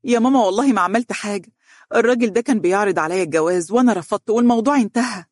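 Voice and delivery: high, hurried tearful protest; choked, tearful voice. A narrator's reading. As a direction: plead innocence as if crying hard. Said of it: "بصوت مختنق".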